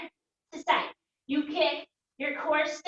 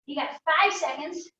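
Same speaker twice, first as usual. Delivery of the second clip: ordinary talk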